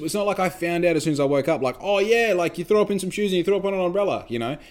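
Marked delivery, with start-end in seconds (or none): 1.8-4.2 high, quick gossipy imitation; hammed-up excited gossip, rattled off fast; mock gossip voice. Mocking someone's excited gossip.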